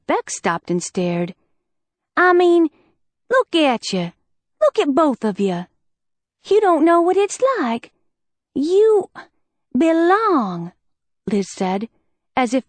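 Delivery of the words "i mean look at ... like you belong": higher, louder, upset outburst; pent-up frustration breaking loose; raised voice. Acted. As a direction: let it pour out, voice raised. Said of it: "heard Liz raise her voice".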